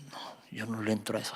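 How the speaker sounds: very soft, low, quick whisper; son's hushed refusal. Acted. Son refusing the drugs offered at school, whispered. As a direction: murmur the refusal as if overheard.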